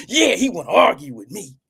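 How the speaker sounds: put-on, gleeful mock voice; mimicking cynical sis. Streamer imitates sis ready to argue with him, mocking. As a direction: play her itching to argue. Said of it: "Y'all know she's cynical".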